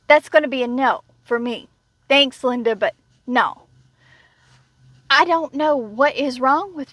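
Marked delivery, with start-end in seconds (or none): none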